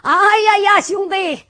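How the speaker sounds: high, loud calling "哎呀呀"; loud cry. Acted. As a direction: shout to be heard from the crowd. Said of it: "有人高喊". (高声喊叫)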